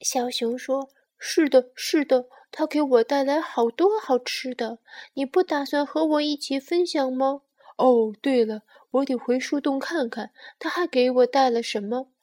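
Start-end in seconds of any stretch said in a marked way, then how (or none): 1.2-12.1 loud, eager cub chatter; delighted little bear. Acted. Bear tells sparrow of mama's treats, delighted. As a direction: bubble over in the bear's voice.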